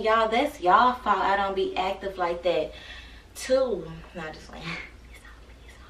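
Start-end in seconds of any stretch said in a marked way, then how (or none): 4.2-5.8 softer, breathy whispered aside; mock-guilty whisper to the camera. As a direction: whisper it like a secret confession.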